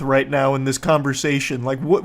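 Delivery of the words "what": usual speaking voice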